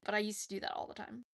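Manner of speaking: usual speaking voice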